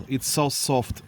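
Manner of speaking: ordinary talk, nothing marked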